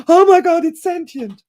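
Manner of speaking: high, loud outburst of alarm; hammed-up shriek of realization; sudden shock. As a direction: blurt it out in mock panic. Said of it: "he's having the response of".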